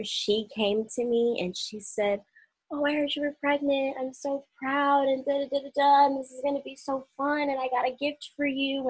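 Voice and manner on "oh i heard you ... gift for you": high-pitched congratulations, imitating a manager; gushing manager. District manager congratulating pregnant employee, gushing. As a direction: gush in a higher voice, as if quoting.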